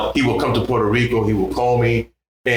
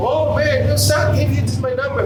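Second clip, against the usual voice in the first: teasing musicians. Retold or imitated. Musicians voiced in story.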